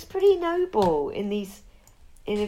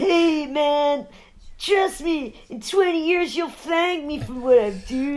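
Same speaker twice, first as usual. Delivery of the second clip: higher, easygoing stoner-style soldier; higher put-on army guy; laid-back soldier in the photo; 5 semitones higher, slower than usual. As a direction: pitch it up and reassure like a buddy. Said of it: "He's like".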